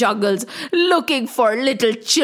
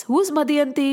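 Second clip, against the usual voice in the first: ordinary delivery, nothing marked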